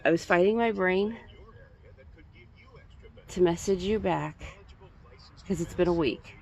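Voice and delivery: ordinary delivery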